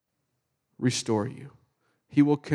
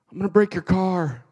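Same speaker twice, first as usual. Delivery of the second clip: loud mock threat; nagging devil; 2 semitones higher, 6 dB louder than usual. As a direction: needle loudly as a pretend tempter. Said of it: "nagging voice in the background".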